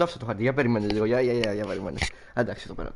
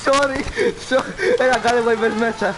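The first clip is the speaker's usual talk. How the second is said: high, loud startled apologies; real high-pitched fluster at the wheel; alarmed yelling; 9 semitones higher, 6 dB louder than usual. Real, not acted. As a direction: yelp out in a driving mishap. Alarmed apologies while driving in game.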